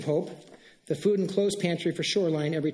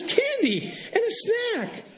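delighted man; much slower than usual. Retold or imitated. Homeless man reacting to gift.

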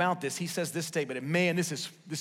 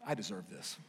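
murmured self-talk; 8 semitones lower, 9 dB quieter than usual. Retold or imitated. Retold inner voice of entitlement.

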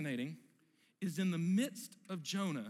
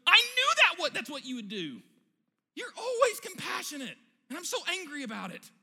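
sulky tantrum; 10 semitones higher, 5 dB louder than usual. Acted. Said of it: "and Jonah goes".

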